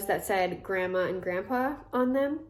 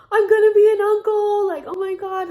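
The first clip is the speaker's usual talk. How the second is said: much higher and louder, thrilled burst; excited uncle-to-be; 12 semitones higher, 8 dB louder than usual. Retold or imitated. Brother hearing he'll be an uncle, thrilled high burst. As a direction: squeal it with delight. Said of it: "he was so emotional and so excited".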